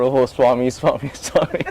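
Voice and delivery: lightly voiced humble devotee, with a laugh; mock reverent sighing of the name; half-hearted imitation of a bowing scholar; fawning Pandit. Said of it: "the Pandit going lower and lower".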